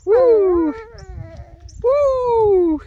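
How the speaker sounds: loud, high whoop of joy; excited whoop. Real, not acted. Hiker whooping with joy on the hike, loud high woo. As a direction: let out a big happy woo. Cheering out on the trail.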